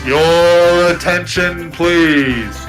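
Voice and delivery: slow, loud PA announcement; train station announcer. Pretend. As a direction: boom it like a station speaker. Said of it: "the train station voice".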